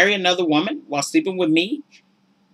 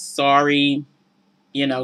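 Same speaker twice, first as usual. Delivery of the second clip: ordinary talk, nothing marked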